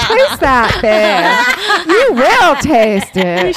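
slow, drawn-out mock command with a laugh; mock bossy order. Friend mock-orders ex's girlfriend to eat fish, slow and bossy. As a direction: drag each word out, teasing. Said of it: "you're already kissing my ass".

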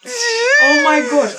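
drawn-out high shocked cry; long shocked oh my gosh; surprised gasp. Real, not acted. Friend recalls the story, exclaims.

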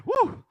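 high, loud whoop of exertion; loud "woo". Real, not acted. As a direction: let out a big winded whoo. Exhales after long energetic story.

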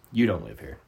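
low, quiet imitation of a stern stranger; deadpan accusation. Stranger staring down the homeowner, imitated low deadpan. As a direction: drop low and soft, imitating her flat challenge. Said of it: "looks me dead in the eye and says".

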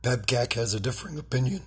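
usual speaking voice

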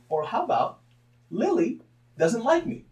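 loud, high, stretched-out rebuttal; emphatic counterargument. Acted. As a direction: spell it out loudly and slowly. Acts out blunt alternative explanation.

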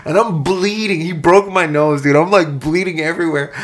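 loud, high-pitched blow-by-blow; animated outrage at the story's climax; worked-up storytelling. Retold or imitated. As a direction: burst out with the punch, keep it loud. Excited climax of fight story.

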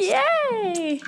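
high, drawn-out yay; delighted cheer. Real, not acted. Player delighted at gaining advantage.

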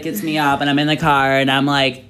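ordinary talk, nothing marked

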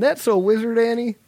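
put-on high-pitched excited kid. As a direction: squeak it out like a small boy. Quoting child character Kitster.

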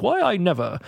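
high-pitched mock indignation; lightly acted huffy offense; mock insulted. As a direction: play a hammed-up affronted lady. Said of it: "she seems, like, insulted".

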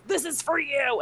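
high and loud, blurted out in nerves; nervous blurt. Retold or imitated. Shoving the card at her fiance, nervous and loud. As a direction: rush it out, too nervous for the speech. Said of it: "I was so nervous".

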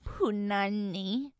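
slow, stretched punani with rising end; drawn out punani. Real, not acted. Host embarrassed by the see-through pants, stretching punani.

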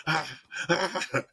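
high, laughing ow ah; mock pained yell. Retelling the husband's cry after being shot, laughing. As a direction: ham up the shot man's cry through laughter. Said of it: "And he gets up".

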